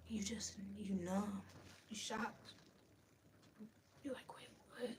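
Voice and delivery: soft, breathy, stunned; a stunned hush; numb whisper. Real, not acted. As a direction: whisper it like you can't believe it. Recalling numb shock.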